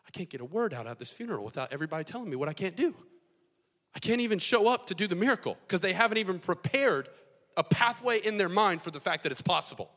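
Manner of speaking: mad, exasperated. Acted. Jesus fed up at the funeral, building exasperated rant. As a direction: vent in mounting frustration. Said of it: "He's really, really mad".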